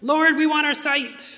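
high, loud pleading cry; bold cry. Retold or imitated. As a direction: cry out boldly to the Lord. No, they knew right away.

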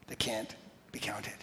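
whispered softly. Real, not acted. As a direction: let the words fall into a hush. Preacher repeats in a hush.

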